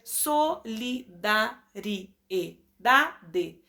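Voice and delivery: solidariedade stretched slowly as so-li-da-ri-e-da-de; very slow syllable by syllable. Shown as a demonstration. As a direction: count every beat of solidariedade slowly. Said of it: "Temos mais de quatro". (solidariedade bem devagar, sílaba por sílaba)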